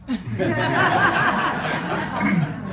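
high, delighted laugh; laughing in disbelief; real laugh. Real, not acted. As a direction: laugh out at the memory. Laughing at getting the job.

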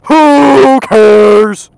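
long, high, loud whoop; drawn-out dismissive shout; mocking holler. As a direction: bellow it, stretching the words. Mock answer to commenters.

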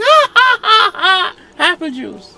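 drunk mock laugh. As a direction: laugh it off like a tipsy wife. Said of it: "She's like".

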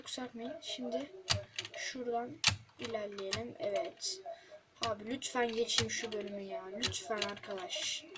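talking low, keeping it down; quiet pleading under breath; hushed. Real, not acted. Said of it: "Televizyon açık bu arada".

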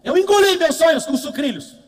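loud, high, excited delivery. Real, not acted. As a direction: belt it out to the crowd. Palestrante empolgado diante do público. (em voz alta e empolgada)

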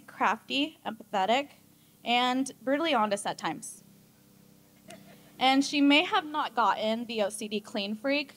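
ordinary talk

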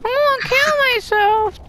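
very high, thin squeaky voice; high squeaky meme voice. Acted. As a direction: squeak it out like a cartoon. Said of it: "[name], say it".

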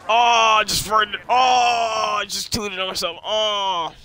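high, loud drawn-out moan; stretched silly cry, loud then easing; hammed-up wailing. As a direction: wail it in mock distress. Joking wail about farting.

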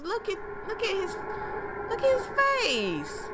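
very high, softened wail; dismayed squeal. Real, not acted. Player seeing chopped-up Tails, high dismayed wail.

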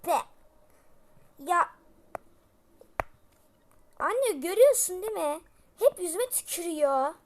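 loud, high tattling whine; a loud, high childish complaint; whining complaint. Acted. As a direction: tattle to mom at full volume. Child complaining about sibling.

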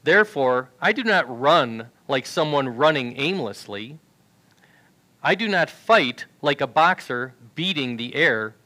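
ordinary talk, nothing marked

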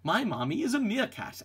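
ordinary delivery

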